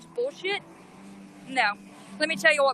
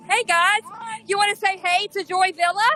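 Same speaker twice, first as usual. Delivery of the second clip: higher and louder, bright hello to viewers; excited livestream greeting; 7 semitones higher, 4 dB louder than usual. Real, not acted. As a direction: hype up the live audience.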